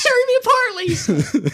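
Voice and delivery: very high, loud mock movie cry; high-pitched yell. Imitating the actor as Tommy, weirdly high yell. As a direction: wail it up high like a melodramatic actor. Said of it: "weirdly high-pitched yell".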